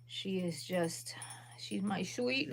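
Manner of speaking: slow, soft whisper. Real, not acted. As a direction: hush so the dog keeps sleeping. Whispering about sleeping dog.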